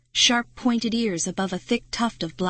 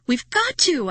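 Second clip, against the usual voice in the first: high, loud shriek; 9 semitones higher, 5 dB louder than usual. Acted. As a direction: shriek at Marty to keep trying. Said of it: "I shrieked".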